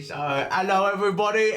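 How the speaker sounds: hello pitched high and bright; high cheery hello. Acted. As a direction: greet everyone with a lift. First greeting, higher.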